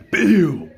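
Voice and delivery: loud, high "pew" falling away; launch sound effect. Retold or imitated. Storyteller imitating the Nomu sent flying, loud high pew.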